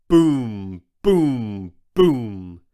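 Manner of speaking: deep heavy booms for giant steps; booming footsteps. A narrator's reading. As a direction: boom out each step like a giant stomp. Giant footsteps sound effect.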